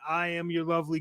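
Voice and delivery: normal talking voice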